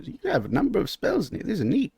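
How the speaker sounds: a curious merchant leafing through the spellbook; shopkeeper voice. Acted. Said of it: "flips through it".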